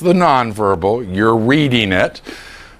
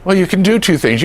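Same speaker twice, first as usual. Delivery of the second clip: usual speaking voice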